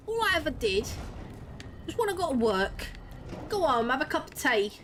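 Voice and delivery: overdone Cockney. Acted. Mocking her boyfriend's Englishness in a hammed-up Cockney accent. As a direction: play a mock-offended Cockney bloke. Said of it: "a Cockney accent".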